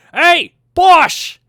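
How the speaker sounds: dwarf yelling for his brother; calling out. Acted. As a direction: bellow for your brother.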